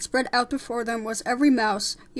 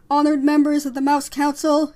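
loud, higher formal address; shouted; 4 semitones higher, 11 dB louder than usual. Acted. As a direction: hail the council at full voice. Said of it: "shouted Frollo".

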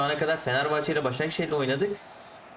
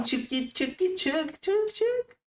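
builds from high to very high, racing yells; frantic cheering as the striker breaks away, rising to a squeal; frantic urging; much slower than usual. Real, not acted. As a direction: push him on, climbing higher each word. Urging the ball carrier, rising frenzy.

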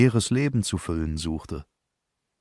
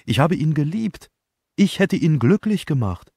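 higher and louder, impassioned; passionate; 9 semitones higher, 5 dB louder than usual. Acted. Elsa insists to Poirot she loved him, impassioned. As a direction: plead your love fiercely. Elsa defends her love.

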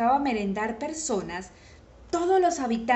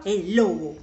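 plain reading voice

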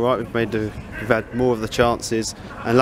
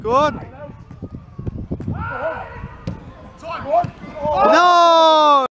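yelling from the sidelines; 13 semitones higher, much slower than usual. Real, not acted. Fan shouting at football match.

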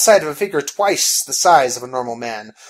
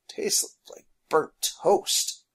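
disgusted; 3 semitones higher, 4 dB quieter, much slower than usual. Acted. Phineas grimacing at coffee.

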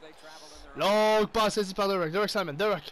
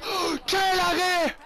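amazed shout; 10 semitones higher, slower than usual. Real, not acted. Gamer shouting in awe at the goalie's save. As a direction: belt out the save like it stunned you.

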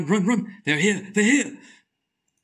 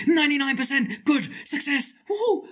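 excited cheer; 11 semitones higher than usual. Real, not acted. Puzzle success.

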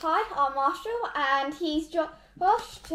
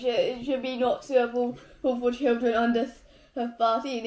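ordinary talk

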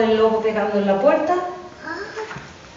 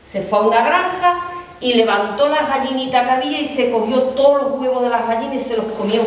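ordinary delivery

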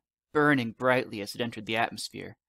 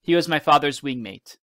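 ordinary delivery

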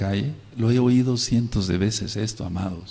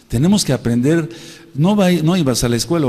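loud, higher exhortation; full-force preaching; 6 semitones higher, 5 dB louder than usual. Real, not acted. Pastor urging congregation to learn, loud preaching. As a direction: drive the point home.

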